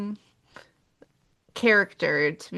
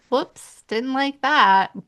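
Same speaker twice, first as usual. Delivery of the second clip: higher-pitched sarcastic disapproval; 6 semitones higher, slower than usual. Acted. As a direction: feign dislike with a drawn-out finish. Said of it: "I was being sarcastic".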